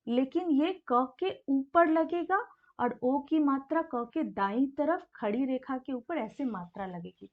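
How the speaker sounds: ordinary talk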